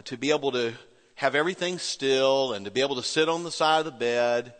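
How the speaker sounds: ordinary delivery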